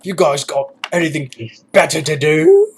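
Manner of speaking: mock British army sergeant major; sergeant major quote. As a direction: bark it like a drill sergeant on parade. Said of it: "quoting some of those, those movies".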